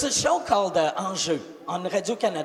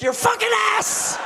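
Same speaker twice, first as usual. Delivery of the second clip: sudden threat; 13 semitones higher than usual. Retold or imitated. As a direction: flip from soothing to yelling. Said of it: "I go".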